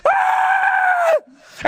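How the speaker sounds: very high, sustained mock scream; acted falling shriek. Skydiver thrown out of the plane, long high scream. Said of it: "And I'm screaming out".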